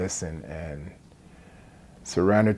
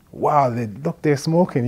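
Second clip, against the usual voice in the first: retold amazement, higher and louder; shocked; 7 semitones higher, 9 dB louder than usual. Newcomer to Canada reacting to teens smoking, shocked. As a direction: act out a stunned "wow", pitched up and loud. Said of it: "almost in shock because I was".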